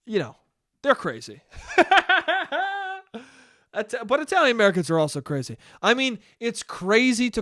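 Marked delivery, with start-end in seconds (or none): 1.6-3.2 high giggling laugh; real amused burst of giggles. Real, not acted. Laughs at own remark.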